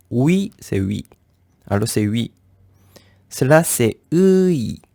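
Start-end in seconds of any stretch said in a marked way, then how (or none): 4.1-4.8 ui stretched slowly from rounded u to i; drawn-out vowel glide. Shown as a demonstration. Teacher introducing the vowel to learners, drawn-out glide. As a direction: hold the u of ui, then slide to i. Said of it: "Alors on prononce ui".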